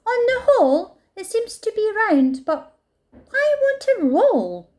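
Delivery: a big animal scratching its head; puzzled rhino. A narrator's reading. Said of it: "and said".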